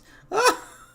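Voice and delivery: high loud witch cackle; hag cackle. Acted. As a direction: shriek a gleeful cackle. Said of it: "The hag cackles".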